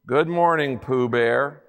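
low, weary gloomy donkey voice; gloomy Eeyore. A narrator's reading. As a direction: sigh it out like a gloomy old donkey. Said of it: "said Eeyore gloomily".

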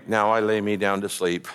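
low monotone bedtime prayer by rote; droning, memorized and mechanical; mechanical recitation. Acted. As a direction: rattle off a memorized prayer without thinking. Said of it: "he's not praying some memory".

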